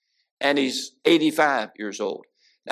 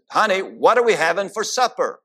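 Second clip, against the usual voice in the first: raised voice, higher, calling across the kitchen; a bit louder call; 6 semitones higher, 3 dB louder than usual. Retold or imitated. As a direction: call out again, louder, to a deaf wife. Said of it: "talked a little louder".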